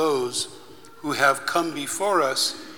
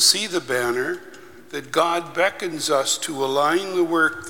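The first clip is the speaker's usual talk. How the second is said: prayer; 2 semitones higher, 4 dB quieter than usual. Acted. Leads a liturgical prayer.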